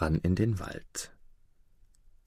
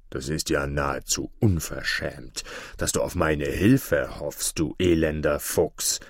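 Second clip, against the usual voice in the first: angry wolf; 5 semitones lower, 4 dB quieter than usual. A narrator's reading. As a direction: scold the wretched fox in a low voice. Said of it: "erwiderte der Wolf aufgebracht". (aufgebrachter Wolf, tief und langsam)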